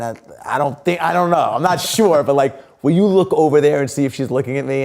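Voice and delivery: hurried nervous whisper-turned-plea to a friend; retelling his jittery ask. Retold or imitated. Anxious retold plea to friend.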